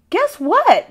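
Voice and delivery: a mother teasing out a big surprise; bright excited guess what; excited news. A narrator's reading. Ma announces good news.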